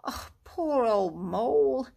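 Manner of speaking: slow, gentle pity with a catch; kindly. A narrator's reading. Rat comforts the injured Mole, kindly. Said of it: "said the rat kindly".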